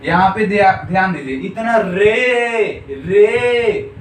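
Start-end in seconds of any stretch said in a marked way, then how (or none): 1.8-4.0 "रे" with the ए held long and loud; drawn-out vowel. Shown as a demonstration. Teacher proving the ए sound to students, "रे" stretched. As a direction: call out "रे", stretching its ए. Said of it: "ए आ रहा है।".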